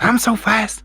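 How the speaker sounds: breathless Ali impression. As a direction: do Ali breathless, like he's winded. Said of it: "He's kind of breathless when he talks".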